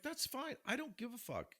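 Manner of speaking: re-enacted unbothered reaction; retold live-and-let-live shrug. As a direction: wave it off in a high voice. Retold easygoing reaction, raised pitch.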